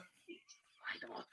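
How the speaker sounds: whispered aside; whispered doubt. Real, not acted. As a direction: whisper it to yourself. Quietly doubting the rumour.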